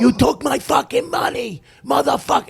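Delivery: furious scream. Retold or imitated. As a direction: scream it like you snapped. Actor attacks him, screaming.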